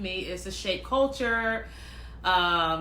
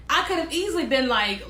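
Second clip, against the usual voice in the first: ordinary talk, nothing marked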